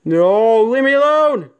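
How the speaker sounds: high, rising yell of fright; real panic, pitch climbing; panicked cry. Real, not acted. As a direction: cry out to drive off the thing. Pleads with ghost in horror game.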